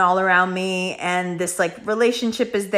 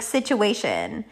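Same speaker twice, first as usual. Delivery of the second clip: normal talking voice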